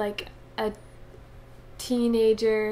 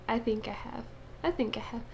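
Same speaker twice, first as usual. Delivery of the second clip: whispering; 8 dB quieter, faster than usual. Pretend. Little engine chant, whispered.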